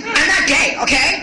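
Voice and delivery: defensive macho male attendant; macho guy voice. Retold or imitated. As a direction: snap back like a touchy macho guy.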